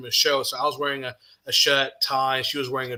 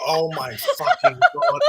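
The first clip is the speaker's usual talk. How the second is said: high, drawn-out relief through laughter; retold relief; 4 dB louder, slower than usual. Best friend learning it's only a spare key, laughing relief. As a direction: laugh out her relieved exclamation. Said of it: "relief. She was like".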